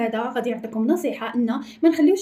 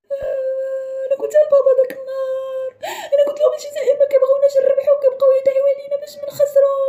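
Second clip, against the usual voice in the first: put-on high sobbing child's voice; crying child; 12 semitones higher than usual. Child crying to the neighbour about his dad's warning. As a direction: wail it like a hurt kid. Kid crying over the taunt.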